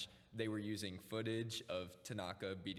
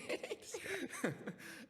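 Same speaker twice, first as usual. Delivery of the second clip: high squeaky laugh. Real, not acted. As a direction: burst out laughing. Laughing at the chaos.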